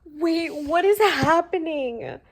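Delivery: loud, high, drawn-out disbelief; shocked. Real, not acted. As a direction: cry out in bewildered shock. Shocked by plot twist.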